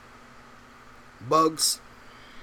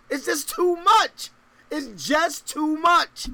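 mock lament; 6 semitones higher, 4 dB louder, slower than usual.

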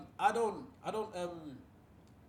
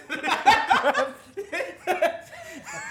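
loud high laughing fit; 11 semitones higher, 10 dB louder than usual. Real, not acted. Group laughter.

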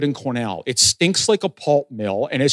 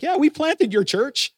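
joking boast; 10 semitones higher, slower than usual. Retold or imitated. Joking with a new pastor.

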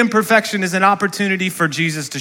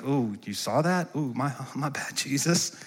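sheepish laugh; 4 semitones lower, 12 dB quieter than usual. Acted. Voicing sheepish Nathanael.

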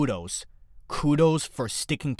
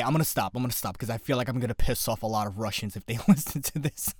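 ordinary delivery

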